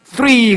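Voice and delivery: three said with the tongue between the teeth; careful th. Shown as a demonstration. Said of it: "혓바닥을 이빨 사이에다 끼라고". (혀를 이 사이에 끼운 three)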